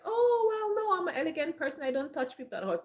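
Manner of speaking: airy, high voice, gliding down as it goes; mock elegant person. Someone too elegant to fight back, mock dainty protest. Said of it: "you're gonna be like".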